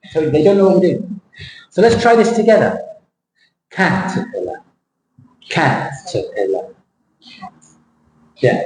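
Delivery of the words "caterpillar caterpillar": CAT-er-pillar, strong first beat; "Caterpillar" with a big CAT and soft tail; stressed first syllable. Shown as a demonstration. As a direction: punch CAT in "Caterpillar", let the rest drop away. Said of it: "which is the strong one?".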